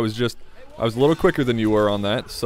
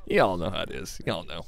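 southern accent; 5 semitones lower, 5 dB quieter than usual. Real, not acted. Player slipping into it while chatting to viewers, southern accent. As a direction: slip into a lower southern accent. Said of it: "Why do I have a southern accent?".

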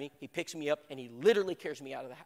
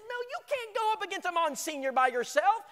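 alarmed protest; 6 dB louder than usual. Retold or imitated.

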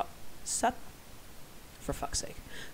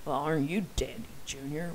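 sarcastic; 2 semitones lower than usual. Shown as a demonstration. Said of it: "if he's being sarcastic or not".

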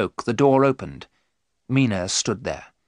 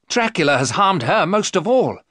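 high, loud indignant protest; angry cry; 9 semitones higher, 5 dB louder than usual. Acted. Jonathan defends his right to tell Mina, angrily. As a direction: protest hotly on her behalf. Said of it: "Jonathan cried angrily".

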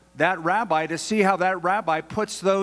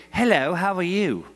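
put-on British accent; 3 semitones higher than usual. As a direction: mimic a stranger with a British accent. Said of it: "with a British accent".

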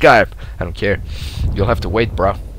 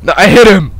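higher, loud insistence; protesting; 5 semitones higher, 6 dB louder than usual. Real, not acted. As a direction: insist loudly on HIT. Protests a hit.

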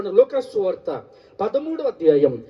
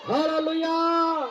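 high, slow, drawn-out hallelujah shout; stretched, soaring praise cry; loud hallelujah; 8 semitones higher, 5 dB quieter, much slower than usual. Real, not acted. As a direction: lift the whole church with a long hallelujah. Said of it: "గట్టిగా రెండు చేతులెత్తి చెప్పండి".

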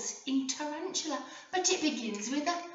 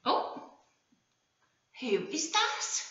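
ordinary talk

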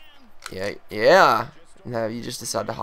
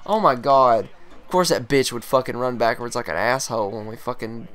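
ordinary talk

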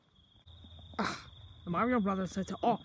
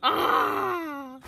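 imitated scream; 6 semitones higher, 6 dB louder than usual. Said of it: "He was all like".

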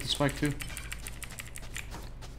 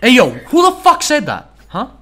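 loud, furious demand at the team; angry shout; 6 semitones higher, 11 dB louder than usual. Real, not acted. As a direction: yell it, outraged. Angry outburst at teammate remark.

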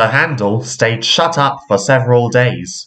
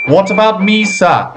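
put-on old engine voice; 8 semitones higher than usual. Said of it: "said a voice".

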